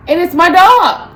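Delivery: loud, slow, high outburst; exasperated. Real, not acted. Annoyed owner complaining about her dog, loud outburst. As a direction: blurt it out in disbelief.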